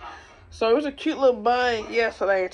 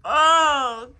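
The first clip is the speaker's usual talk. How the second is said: loud cry, then slow drawn-out despair; loud cry sinking into slow despair; anguished groan; 4 semitones higher, 5 dB louder, much slower than usual. Real, not acted. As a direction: moan to the heavens. Groaning, stuck on a game.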